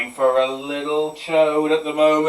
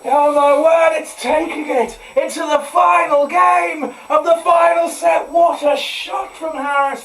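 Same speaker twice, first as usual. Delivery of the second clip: high, loud sports-commentary shouting; excited commentator; 12 semitones higher, 9 dB louder than usual. Pretend. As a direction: call the winning shot like a live commentator.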